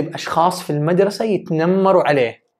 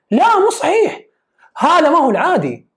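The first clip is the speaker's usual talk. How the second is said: sharp, much higher and louder no; emphatic denial; 11 semitones higher, 4 dB louder than usual. Real, not acted. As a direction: reject it forcefully. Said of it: "emphatic rejection". (رفض حاد بصوت عالٍ ومرتفع)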